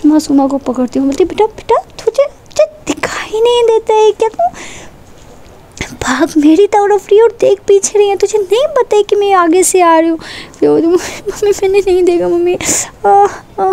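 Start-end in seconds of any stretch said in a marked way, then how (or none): 1.3-10.2 much higher, alarmed scolding with a crying edge; panicked mom telling off her careless daughter; scolding mother. Acted. 10.8-13.7 higher, whimpering plea ending in cries; a hurt girl begging her mother; acted crying. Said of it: "मतलब वो रोने लग जाती है".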